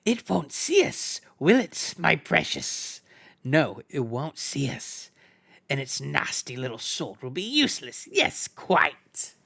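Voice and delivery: gloating over the plan, secretive; Gollum voice. A narrator's reading. Gollum plotting aloud.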